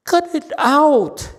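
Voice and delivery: loud, high, slow cut it out; exasperated shout. Real, not acted. Master shouting at distracted students, loud and high. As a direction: bark it at the class to stop.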